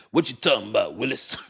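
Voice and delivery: lightly voiced sitcom catchphrase; lightly mocked catchphrase; Arnold quote. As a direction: tease it like the kid on TV. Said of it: "looking at me like".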